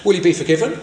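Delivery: ordinary talk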